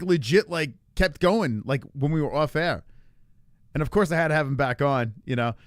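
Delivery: normal talking voice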